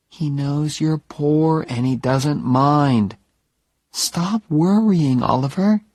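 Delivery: calm him down, gently and slowly; soothing Jenny. Acted. Narrator voices Jenny, soothing.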